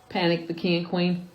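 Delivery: usual speaking voice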